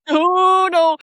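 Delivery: long high wail of comic dismay; retold panic, high and loud; mock horror. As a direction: cry out in exaggerated dread. Retold inner horror at being spoken for.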